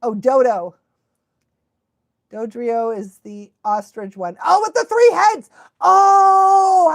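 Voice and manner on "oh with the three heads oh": sudden recognition. Real, not acted. Suddenly remembering.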